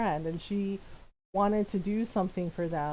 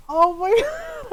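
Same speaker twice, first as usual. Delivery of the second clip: breaking into real laughter, louder and higher; can't get the words out for laughing; 14 semitones higher, 6 dB louder than usual. Real, not acted.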